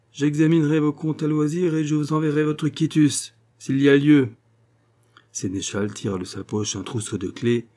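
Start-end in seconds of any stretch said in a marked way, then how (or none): 0.1-4.3 higher, louder officious tone; cutting, superior notary; haughty dismissal. A narrator's reading. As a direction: dismiss the clerk with lofty formality.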